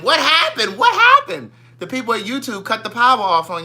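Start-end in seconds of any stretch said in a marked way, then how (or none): 0.0-1.5 high mock confused asking; mocking chat viewers. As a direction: squeal it like a puzzled viewer. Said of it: "look, they all saying".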